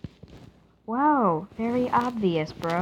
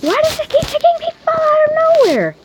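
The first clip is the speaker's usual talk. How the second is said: very high, loud shriek; frustrated scream; 10 dB louder than usual. Real, not acted. Gamer raging at a sudden fall, shrieked frustration. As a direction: scream the question in exasperation.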